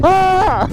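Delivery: high mimicked animal cry; groundhog cry imitation. Vlogger mimicking the groundhogs from the clip, high yelp. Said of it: "Are they the ones that go".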